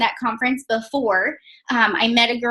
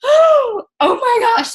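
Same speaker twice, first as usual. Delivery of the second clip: much higher, louder, slow drawn-out shocked cry; stunned outcry; 12 semitones higher, 6 dB louder, much slower than usual. Retold or imitated. Wife cries out as her husband says he quit, stunned and drawn out. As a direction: let it burst out in disbelief. Reacting to husband quitting job.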